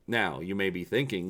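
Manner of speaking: usual speaking voice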